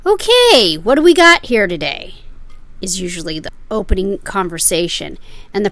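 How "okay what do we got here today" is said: loud, much higher chirpy shop greeting; shopkeeper greeting. Retold or imitated. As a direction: hail the customer like a TV pawn dealer. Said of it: "one of the guys from the shop will say".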